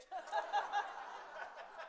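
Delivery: high unvoiced burst of laughter; breathy laugh. Real, not acted. Chef cracks up after calling himself an asshole, laughing. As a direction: laugh at your own punchline.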